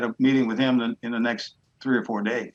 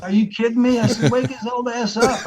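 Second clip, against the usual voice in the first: high, louder indignant protest; 10 semitones higher, 5 dB louder than usual. Retold or imitated. Player to secretary, indignant loud protest. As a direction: demand it in disbelief. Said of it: "I'm like".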